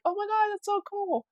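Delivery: high-pitched gush; retold delighted squeal. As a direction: squeal with joy at the offer. Her own delighted reply, retold.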